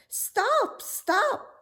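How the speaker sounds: very high, loud call; frog crying out. A narrator's reading. Frog calling after the fleeing princess, loud high cry. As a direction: shout after her to stop. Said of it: "cried the frog".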